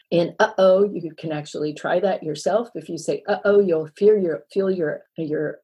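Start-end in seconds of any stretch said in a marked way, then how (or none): 0.4-0.9 "uh-oh" with a catch in the throat between syllables; glottal stop. Shown as a demonstration. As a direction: stop the air midway through "uh-oh". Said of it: "is a glottal stop".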